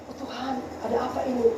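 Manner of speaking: low, soft, quick murmur; hushed retold question. Preacher reliving waking at night, hushed bewildered prayer. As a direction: ask God under your breath.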